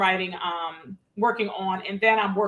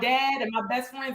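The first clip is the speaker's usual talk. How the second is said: ordinary delivery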